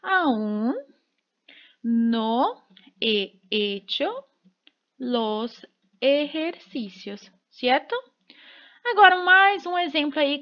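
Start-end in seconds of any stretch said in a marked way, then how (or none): 0.0-7.3 slow, word by word with pauses; slow dictation. Shown as a demonstration. Teacher dictating the Spanish example to students, slow and deliberate. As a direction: spell it out for learners, slowly. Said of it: "que em espanhol ficaria assim".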